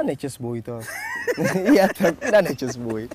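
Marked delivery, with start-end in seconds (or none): 1.3-3.1 amused, laughing agreement; chuckling as you say it. Real, not acted. Amused reply to host's remark.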